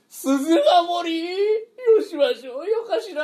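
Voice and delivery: high, drawn-out frightened cry; terrified wail. Acted. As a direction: shriek it out in dread.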